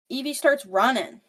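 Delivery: ordinary talk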